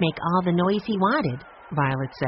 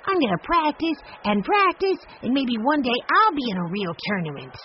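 put-on higher, keen boy's voice; a boy promising to practice; eager little boy; 7 semitones higher than usual. A narrator's reading. Benny tells Grandfather eagerly he'll practice at home.

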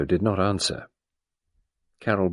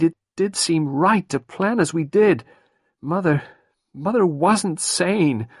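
put-on higher, anxious young woman pleading; Carol, young and overwrought; distressed young woman; 9 semitones higher than usual. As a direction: pour it out, near tears. Carol recalls the plan.

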